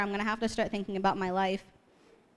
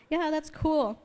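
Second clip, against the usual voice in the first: uncheesy thanks; 7 semitones higher, slower than usual. Retold or imitated. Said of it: "cheesy person, so I'm like".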